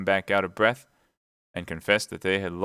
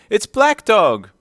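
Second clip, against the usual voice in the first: shouting; 14 semitones higher, 10 dB louder, slower than usual. A narrator's reading. Shouted name take.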